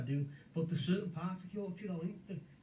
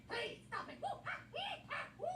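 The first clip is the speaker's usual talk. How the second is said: much higher, softer mock squealing; 7 dB quieter than usual. Teasing a housemate with high soft squeals. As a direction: squeak it out in a silly falsetto.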